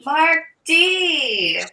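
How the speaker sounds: high, loud, drawn-out name call. Real, not acted. Streamer hailing Mark who joined, high excited call. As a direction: sing out the name in greeting. Calling out to chat arrival.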